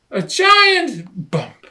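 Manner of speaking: high, loud, slow suspense; dramatic build. A narrator's reading. Narrator builds to the crash, high loud dramatic read. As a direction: lean into the bump.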